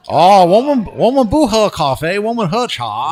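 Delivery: high, loud, hammed-up old-movie character; campy retro film parody, high and loud; old Chinese movie voice. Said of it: "In all the old Chinese movies".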